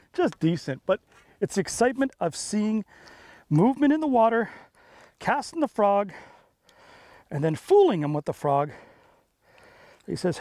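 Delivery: ordinary talk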